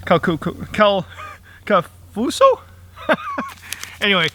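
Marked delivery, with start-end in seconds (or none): none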